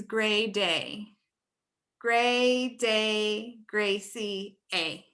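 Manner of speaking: "Gray Day, Gracie A" slowly, with the long ay vowel stretched; slow name drill. Shown as a demonstration. Teacher modeling her own name's vowel color slowly. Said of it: "let's do this with our name".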